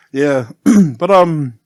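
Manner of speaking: ordinary talk, nothing marked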